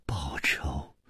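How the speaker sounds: barely voiced low whisper; very soft, low disbelief; hushed questioning. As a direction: echo the word under your breath. Hushed echo of lover's vengeance cry.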